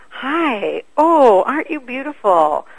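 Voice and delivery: put-on cooing to a child. Distracting a child in a store.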